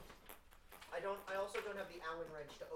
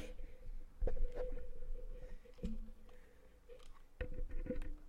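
high laugh; 5 dB louder than usual. Real, not acted. Laughing at the shoulder bit.

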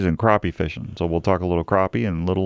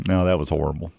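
ordinary talk

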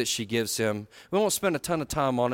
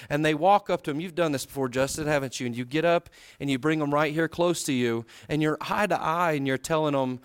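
ordinary delivery

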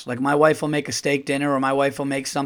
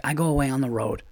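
ordinary talk, nothing marked

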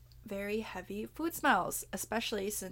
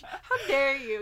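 higher, louder mock indignation; mock outrage; 6 semitones higher, 7 dB louder, slower than usual. Host teasing her co-host, mock outrage. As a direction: play offended, hammed up.

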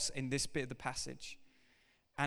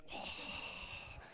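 sigh; 12 dB quieter than usual. Real, not acted. Daunted by a long passage.

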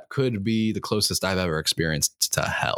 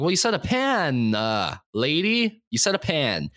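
higher-pitched, dragged-out comeback; exasperated retort; 7 semitones higher, slower than usual. Retold or imitated. Arguing with mom over pots and pans, exasperated. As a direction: argue back, stretching out the lady.

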